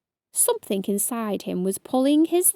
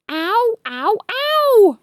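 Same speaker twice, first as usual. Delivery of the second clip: high, louder wail of pain; 11 semitones higher, 6 dB louder, slower than usual. A narrator's reading. Ladybird bitten again, high wail. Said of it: "wailed Stripey".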